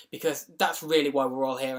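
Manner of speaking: ordinary delivery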